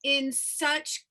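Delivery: normal talking voice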